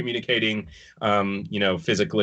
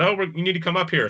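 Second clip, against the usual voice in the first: half-hearted retold yell, pitched up; mock yell; 8 semitones higher, faster than usual. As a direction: mimic someone calling down to you, voice raised in pitch only. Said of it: "someone yells down".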